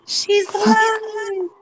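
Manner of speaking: slow, high, crying-out welcome; drawn-out she's alive. Real, not acted. Friend greets the latecomer on the call with a slow, high wail. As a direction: stretch it out like a joyful wail.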